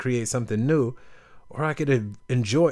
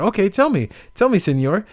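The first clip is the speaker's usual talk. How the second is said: high, louder, playful; obliging fisherman; 8 semitones higher, 5 dB louder than usual. Retold or imitated. Fisherman inviting the tourist's plan, playful. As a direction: humor him, amused. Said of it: "He's like".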